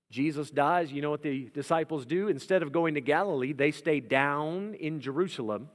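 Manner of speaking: ordinary talk, nothing marked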